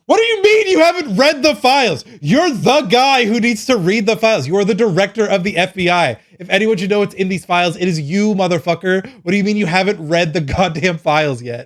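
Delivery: high, loud, incredulous yelling at him; exasperated rant. Retold or imitated. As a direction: chew him out in disbelief. Ranting at Kash Patel on files.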